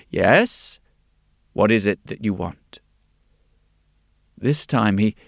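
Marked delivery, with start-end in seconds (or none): 0.1-2.8 steady, assured shopkeeper's question; confident. A narrator's reading. Glasses maker usually greeting customers, confident. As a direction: ask it readily and confidently. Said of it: "quite confidently would say".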